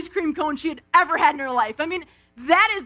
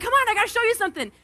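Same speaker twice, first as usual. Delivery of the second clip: quick, higher, eager; eager hurry; 7 semitones higher, much faster than usual. Retold or imitated. Aunt retells hurrying her niece outside, quick and eager. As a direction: rush her along excitedly.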